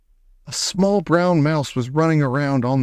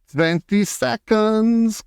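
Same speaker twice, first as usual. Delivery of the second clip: very slow, higher witch count; a lightly voiced witch calling out; witch crying out; 8 semitones higher, much slower than usual. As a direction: draw out the seconds like a witch. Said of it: "cried the Grand High Witch".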